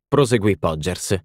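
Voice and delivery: plain reading voice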